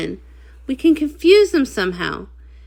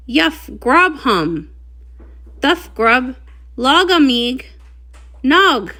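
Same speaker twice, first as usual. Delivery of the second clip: muffled through mask; 4 dB quieter, slower than usual. Acted. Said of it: "The mask makes it hard for ponies to hear me".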